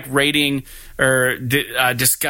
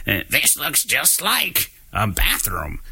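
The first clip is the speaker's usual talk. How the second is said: Beavis voice; slower than usual. Retold or imitated. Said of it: "Beavis is like".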